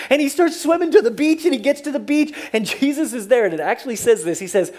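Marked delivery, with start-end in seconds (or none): none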